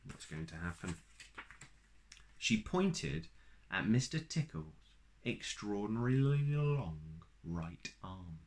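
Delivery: plain reading voice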